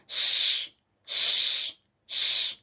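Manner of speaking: a soft voiceless shhh with no vowel; श as a pure whispered hiss; hissed sh sound. Shown as a demonstration. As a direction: breathe out श like hushing someone. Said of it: "इस तरीके से".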